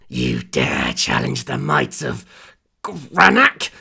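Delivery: menacing demon challenging the hero. A narrator's reading. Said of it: "the demon said".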